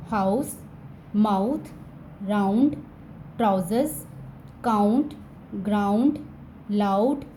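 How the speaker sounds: each word said apart with a clear "au": "क्लाउड", "हाउस", "माउथ". Shown as a demonstration. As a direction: sound out the "au" in every word. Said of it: "O यू की sound आती है औ".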